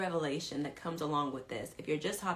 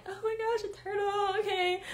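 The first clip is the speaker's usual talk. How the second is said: put-on high, excited girly squeal; girly girl squeal; 13 semitones higher than usual. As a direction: gush over the turtle like a girly girl. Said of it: "I'm a girly girl".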